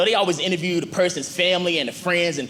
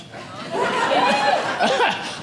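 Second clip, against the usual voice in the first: high laugh. Real, not acted. Laughing at own joke.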